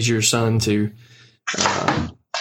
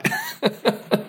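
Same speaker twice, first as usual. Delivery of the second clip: brief, high-pitched laugh; real amused chuckle; 8 semitones higher, 3 dB quieter than usual. Real, not acted. Host deciding this guy might be all right, amused chuckle. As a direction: chuckle after your own punchline.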